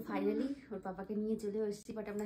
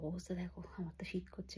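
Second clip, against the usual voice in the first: whispering; 3 semitones lower, 6 dB quieter than usual. Real, not acted. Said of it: "আমরা আস্তে আস্তে কথা বলছি".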